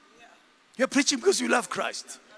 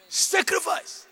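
usual speaking voice

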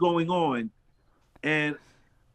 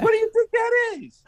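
much higher, louder curious voice; high excited neighbor voice; 5 dB louder than usual. Retold or imitated. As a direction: voice him high and eager. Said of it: "He said".

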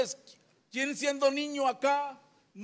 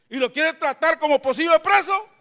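ordinary talk, nothing marked

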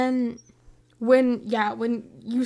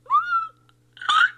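very high, louder shriek; mock shriek; 6 dB louder than usual. [name] hitting Tony, a high mock shriek. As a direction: let out a piercing little scream. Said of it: "I was like".

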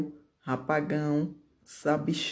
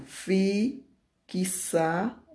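"fixado" slowly split, each part separated by a pause; slow, segmented "fixado" with rising parts; syllable by syllable; 3 semitones higher, much slower than usual. Shown as a demonstration. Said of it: "Três sílabas".

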